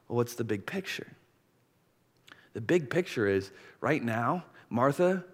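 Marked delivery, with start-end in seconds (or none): none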